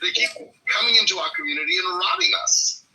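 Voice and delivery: put-on mid-Atlantic accent. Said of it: "kind of a mid-Atlantic accent".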